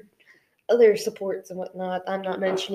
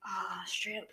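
whispering; 4 semitones lower, 13 dB quieter than usual. Real, not acted. Hushed agreement to a wisdom line.

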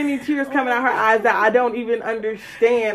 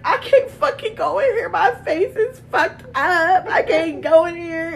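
mock whining; 4 semitones higher than usual. Acts out upset friend refusing.

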